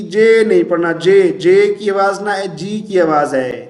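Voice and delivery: ordinary delivery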